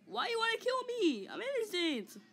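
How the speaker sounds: high, whiny mock plea; pleading protest. Play victim pleading with the attackers, high mock whine. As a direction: plead innocence in a high-pitched wail.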